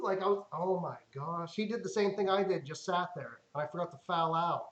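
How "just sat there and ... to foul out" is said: ordinary talk, nothing marked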